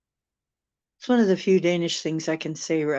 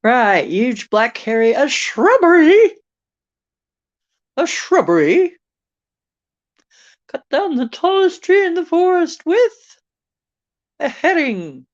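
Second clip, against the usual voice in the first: high, loud mock-commanding voice; shrill-pitched absurd demand, drawing out "shrubbery"; Knights of Ni; 11 semitones higher, 7 dB louder than usual. Quoting a Monty Python film to viewers, hammed-up Knights of Ni. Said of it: "That was the Knights of Ni from Monty Python".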